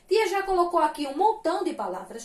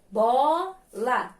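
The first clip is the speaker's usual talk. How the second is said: "Bola" drawn out in two separate syllables; very slow syllables; 3 semitones lower than usual. Shown as a demonstration. Teacher showing pupils two syllables, very slowly. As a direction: stretch "Bola" slowly, pausing before the second syllable. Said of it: "vou falar bem devagarzinho a palavrinha bola".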